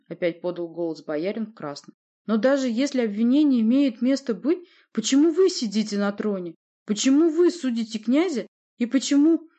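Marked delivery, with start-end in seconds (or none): 2.3-9.5 high, loud questions, rising in force; insistent protest. A narrator's reading. Boyar challenges the sorceress's right to judge, insistent. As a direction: press each why harder. Boyar presses objection until cut off.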